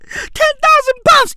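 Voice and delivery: high and loud, stunned by the figure; incredulous exclaim. Acted. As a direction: blurt the number out in disbelief. Repeating the wage in disbelief.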